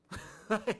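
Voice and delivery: loud, high amused laugh; real laugh; burst of laughter. Real, not acted. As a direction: laugh out at the absurdity. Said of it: "I don't mean to laugh".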